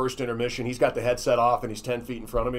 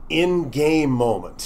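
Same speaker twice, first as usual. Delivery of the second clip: slow thinking aloud; 3 semitones higher, 5 dB louder, much slower than usual. Real, not acted. Musing while repeating the question.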